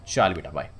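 ordinary talk, nothing marked

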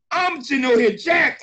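excited shout. Real, not acted. Overwhelmed by the training.

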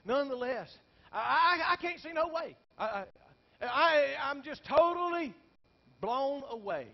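high, loud preacher's cry; preaching at full force. Acted. Pastor tells congregation his despair, loud preaching cry. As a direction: lift the congregation with it.

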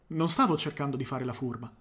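plain reading voice

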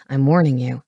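low, firm warning; growled warning. A narrator's reading. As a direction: voice a lightly growled threat. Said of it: "He gave a rough growl".

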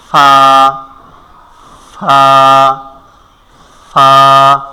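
ফা said firmly, lip on teeth; letter demo. Shown as a demonstration. Teacher repeats ফা for the class. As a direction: repeat ফা cleanly. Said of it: "নিচের ঠোঁটের পেট".

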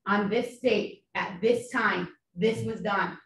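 slow, measured courtroom pacing; deliberate, slowed delivery. Shown as a demonstration. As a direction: lay each phrase down slowly. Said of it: "demonstrating deliberate testimony".

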